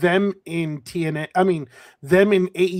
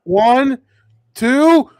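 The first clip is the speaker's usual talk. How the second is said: rising excitement, counting along; excited pin count; 7 semitones higher, 7 dB louder than usual. Real, not acted.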